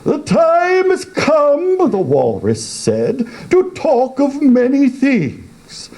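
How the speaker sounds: walrus voice. A narrator's reading.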